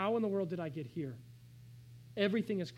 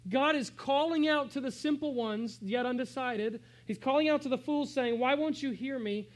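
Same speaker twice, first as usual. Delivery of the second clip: louder, raised, slow preaching; preaching at full force; 5 semitones higher, 6 dB louder than usual. Acted. Pastor urges congregation, full-force preaching. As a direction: proclaim it to the room.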